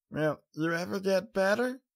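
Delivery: put-on high, tired little-girl voice; a small child muttering wearily; young filly, tired. A narrator's reading. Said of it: "she muttered tiredly".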